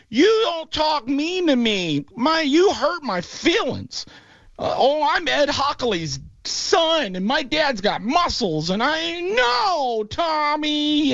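mock the ref as a hurt little kid; mocking whiny referee. Mocking the referee who penalized Brady, whiny and high. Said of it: "And the referee's like".

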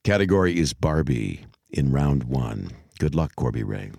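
low, hushed game-show announcer; put-on host voice. Quiz host wishing Corby and listeners luck, low put-on announcer voice. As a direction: drop the voice low and solemn to open the round. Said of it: "Why am I talking like this?".

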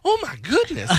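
high, laughing mock gasp; retelling the audiobook assistant, high mock gasp. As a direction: squeal it like a startled assistant. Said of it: "my assistant, uh, Maisie, was like".

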